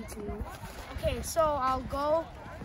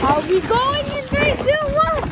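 high, loud countdown and cheer; sledding downhill; 10 semitones higher, 8 dB louder, slower than usual. Real, not acted. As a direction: count down and whoop going downhill.

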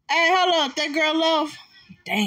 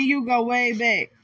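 usual speaking voice

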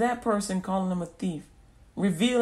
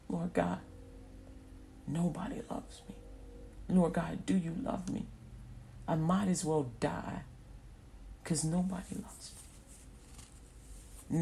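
despairing prayer; 10 dB quieter than usual. Retold or imitated. Unloved person praying to God at night, hushed despair. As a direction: pray it quietly, nearly giving up.

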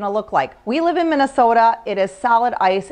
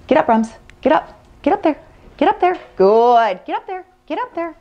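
calling a dog; 5 semitones higher than usual. Real, not acted. Coaxing dog onto board.

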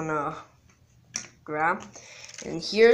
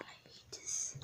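frustrated whisper; 18 dB quieter than usual. Real, not acted.

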